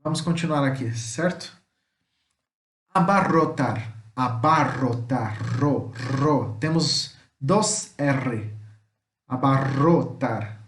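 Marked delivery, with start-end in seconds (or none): 2.9-6.6 Abarrotar with a strongly trilled rr; vibrating rr in Ro; rolled Spanish rr. Shown as a demonstration. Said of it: "Que vibra". 9.3-10.6 slow Abarrotar with a long trilled rr; Abarrotar, vibrating on the rro; rolled Spanish rr. Shown as a demonstration. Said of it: "Que vibra".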